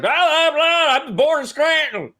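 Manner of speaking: high, slow, loud mock Biden voice; Biden impression. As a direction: ham up a rambling old politician. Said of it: "He's like".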